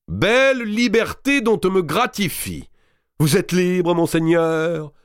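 high, sneering mimicry of a jailer; mock officer voice; mocking the captain. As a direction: parody the captain's pompous release. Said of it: "imitant le capitaine du Châtelet".

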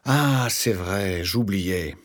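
as if it has just dawned on you; sudden realization. A narrator's reading. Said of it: "comme illuminé par une vérité soudaine". (soudaine illumination, voix traînante)

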